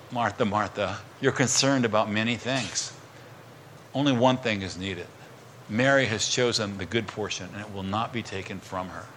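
low, soft, tender reply; calm her down softly; gentle Jesus. Retold or imitated. Jesus gently correcting busy Martha, low and soft. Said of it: "And Jesus says".

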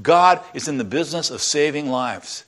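ordinary talk, nothing marked

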